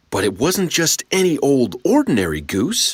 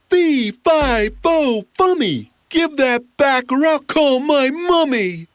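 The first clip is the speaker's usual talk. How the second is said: higher, louder giant roar; 13 semitones higher, 3 dB louder than usual. Acted. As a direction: roar the rhyme. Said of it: "roared the giant".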